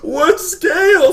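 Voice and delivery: very high, loud, drawn-out wail; flustered panic. Retold or imitated. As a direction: wail in total confusion. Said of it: "I'm just completely flustered".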